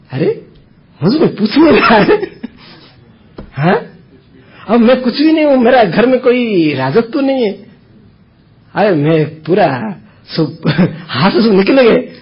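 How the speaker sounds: high-pitched, hammed-up self-pitying wail; mock lament. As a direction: lament like a neglected man, half laughing. Acting out neglected man's lament.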